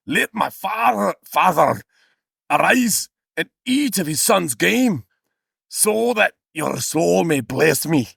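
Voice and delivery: high, slow mock-Scottish recitation; Scottish accent. Son asking his father's blessing, mock Scottish accent. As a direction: declaim it slowly in a Scottish accent. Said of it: "confuse a Scottish accent".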